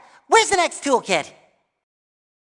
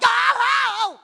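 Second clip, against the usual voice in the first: high, drawn-out cartoon "oh, oh"; startled wail; 11 semitones higher than usual. Startled by the turret, high wail.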